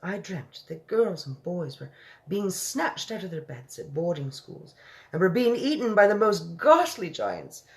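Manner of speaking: the Queen, lightly voiced, shaken by her dream; a refined queen telling a vivid nightmare.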